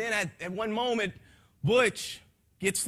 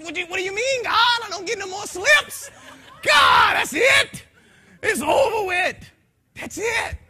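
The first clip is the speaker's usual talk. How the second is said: high, loud panicked shouting; desperate cry to God; 14 dB louder than usual. Retold or imitated. Man begging God after his last chance, panicked shouts. Acting out despairing plea to God.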